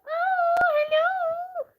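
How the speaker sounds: squeaky high coo to a pet; sweet pet voice. Real, not acted. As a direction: coo hello up high to the hamster. Cooing at her hamster.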